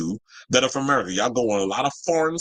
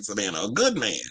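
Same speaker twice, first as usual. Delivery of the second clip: voicing Eve; 2 semitones lower than usual. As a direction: purr it like a contented Eve. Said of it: "She like".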